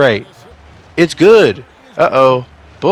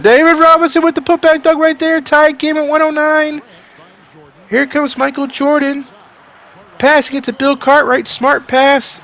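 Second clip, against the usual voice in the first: high-energy sportscaster call, pitched up; excited play-by-play; 8 semitones higher, 3 dB louder than usual. Acted. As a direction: call the game like a live broadcaster.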